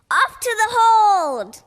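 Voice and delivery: loud, slow, high cry; rallying shout, drawn out; yelled. Acted. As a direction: call the crew off to the hold. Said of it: "Peachy yelled".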